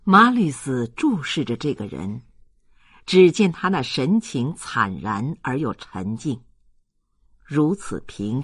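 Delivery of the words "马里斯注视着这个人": usual speaking voice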